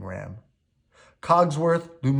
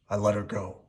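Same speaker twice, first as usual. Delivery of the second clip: sadly; 3 semitones lower than usual. A narrator's reading. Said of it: "he said sadly".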